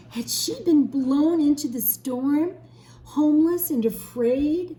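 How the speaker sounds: put-on teacher reading the storm scene to children, with growing drama; dramatic story narration. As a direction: build the tension of the storm.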